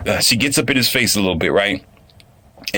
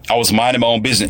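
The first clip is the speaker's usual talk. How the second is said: ordinary talk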